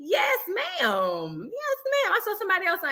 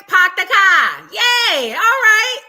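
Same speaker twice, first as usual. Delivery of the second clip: excited cheer; 6 semitones higher, 9 dB louder, slower than usual. Real, not acted. Celebrating a viewer arriving.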